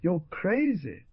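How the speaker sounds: high, drawn-out mock; slow, mocking storybook retort. A narrator's reading. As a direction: scoff slowly on a high pitch. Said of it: "replied the Skinner with a mocking accent".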